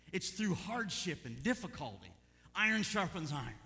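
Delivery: a rising preacher's call; preaching. Acted. Preacher urges the church, voice lifted. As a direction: press the point on the church.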